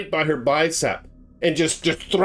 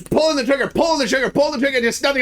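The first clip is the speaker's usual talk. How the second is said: much higher, urgent retelling; rising panic; 8 semitones higher, much faster than usual. Retold or imitated. Teller reliving Matthew's panic at the jammed gun, higher and urgent. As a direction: climb in pitch with the panic. Panicked trigger pulling retold.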